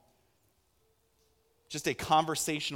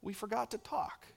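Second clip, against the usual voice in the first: very soft, high little voice; tiny, quiet bedtime plea; small child's voice; 7 semitones higher, 8 dB quieter than usual. Retold or imitated. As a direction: imitate a toddler, hushed and high. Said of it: "I hear this little voice".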